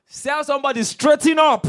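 high, very loud cry; full preaching shout. Real, not acted. Preacher rousing the congregation, very loud shout. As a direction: blast it to the hall.

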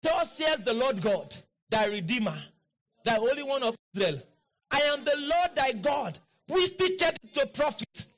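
high, chant-like proclamation building to a shout; full-force preaching declaration. Real, not acted. Preacher leading congregation in "He leads me to profit", high chanting declaration. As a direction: declare each line high and forceful, ending in a cry.

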